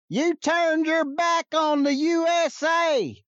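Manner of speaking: high, slow yell from the crowd; a kid in the crowd yelling at the turncoat, high and drawn out; imitated fan yell. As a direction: call it out long and high like a fan in the stands. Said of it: "He yells out".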